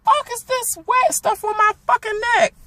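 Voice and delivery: very high, loud and startled; retelling the egg landing on her neck in shock. Retold or imitated. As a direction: scream it as the wet egg hits her neck. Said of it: "I'm like".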